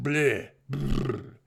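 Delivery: drawn-out "bleh", then a lip-trilled shudder; disgusted bleh and brr. Real, not acted. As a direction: groan out a queasy bleh and shudder it off. Sums up feeling sick.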